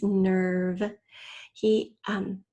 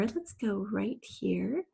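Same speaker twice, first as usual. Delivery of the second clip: ordinary delivery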